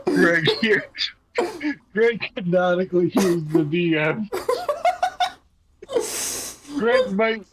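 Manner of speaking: put-on booming Eastern European brute in a Russian accent; big burly Soviet man voice. Player voicing Greg for the table, Russian accent. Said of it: "Greg's voice is partially based on Gru in Heavy".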